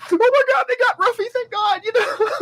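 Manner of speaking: very high, loud, squealing sob-laugh; mock tearful relief. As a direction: gush over the rescued dog. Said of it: "I'm like".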